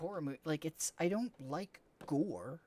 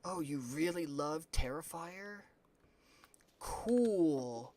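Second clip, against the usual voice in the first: fake enthusiasm; slower than usual. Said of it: "pretend, like".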